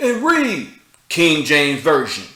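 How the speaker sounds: lightly hammed-up preacher proclaiming; half-mock a bullhorn preacher, slow and loud; mock street preacher. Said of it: "I want you to".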